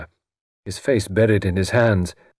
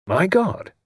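higher, sudden alarmed exclamation; startled cry; 10 semitones higher than usual. Acted. Evesham startled by a ghostly sigh, crying out. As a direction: jump at the eerie sigh. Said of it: "cried Evesham, starting".

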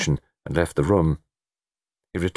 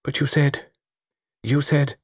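pleading; 8 semitones higher than usual. A narrator's reading. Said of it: "she pleaded".

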